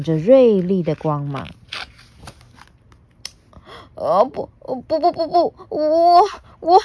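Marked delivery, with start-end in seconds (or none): 3.9-6.8 stammering, breaking, nervous laugh; shaking with fear. Acted. T-rex stammering before the dragon, shaking. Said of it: "霸王龙全身发着抖说".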